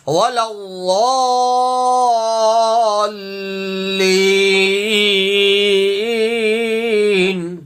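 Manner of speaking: الضالین stretched out for a long count; long madd. Shown as a demonstration. Teacher demonstrates the long madd in recitation. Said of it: "کھینچ کے پڑھیں گے".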